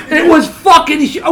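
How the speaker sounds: loud, slow disbelieving yell; excited outburst. Real, not acted. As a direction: blurt it out, half shouting in disbelief. Recounting shock at the scene.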